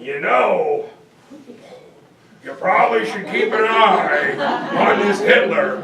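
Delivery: a hint of Churchill's growl; Churchill impression. Churchill warning Parliament, light Churchill voice.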